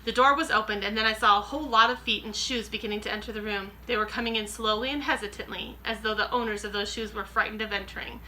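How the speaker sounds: plain reading voice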